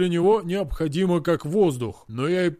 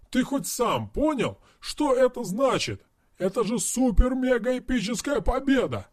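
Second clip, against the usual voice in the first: high, gushing excitement; excited raving; 6 semitones higher than usual. Acted. Friend gushing over the hero's win. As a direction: rave about his huge win.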